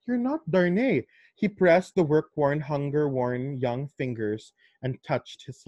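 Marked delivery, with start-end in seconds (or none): 0.1-1.1 high, startled realization; sudden realization. Retold or imitated. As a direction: it suddenly dawns on her. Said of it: "she saw nga".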